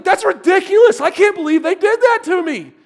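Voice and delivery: loud, high-pitched outraged outburst; fuming. Retold or imitated. Wound-up David exploding at the news, fuming. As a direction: blow up as the wound-up friend. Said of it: "David is fuming".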